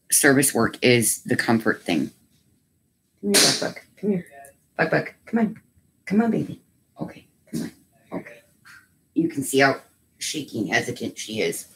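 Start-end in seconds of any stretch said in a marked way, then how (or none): 3.2-8.4 high, soft coaxing. Real, not acted. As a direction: gently call the pet over. Coaxing a scared pet.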